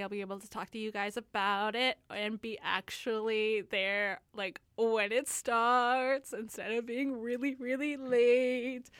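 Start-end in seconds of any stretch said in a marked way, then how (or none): 4.8-8.9 drawn-out singsong, slowed down; sing-songy voice. Real, not acted. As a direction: stretch the words in a singsong. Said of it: "sing-songy voices".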